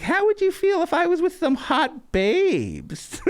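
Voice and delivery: hammed-up, high-pitched whine; slow, squeaky mock indignation, drawn out at the end; imitating Gino. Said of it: "And he's like".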